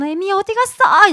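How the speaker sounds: high, loud mock wail for mother; hammed-up infant outcry; clingy baby demanding mom. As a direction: call out as a bossy baby hunting for mom. Acts baby calling for missing mother.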